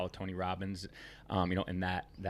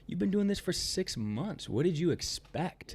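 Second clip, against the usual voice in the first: mentor's incredulous question; 6 semitones higher than usual. Retold or imitated. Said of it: "He's like".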